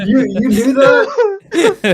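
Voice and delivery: high, slow, laughing; laughing disbelief. Real, not acted.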